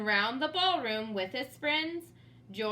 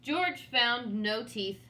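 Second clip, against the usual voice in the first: ordinary delivery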